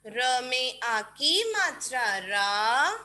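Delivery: put-on slow, drawn-out dictation chant for kids. Shown as a demonstration. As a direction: spell each syllable out slowly for children to write. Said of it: "बोल बोल के लिखेंगे".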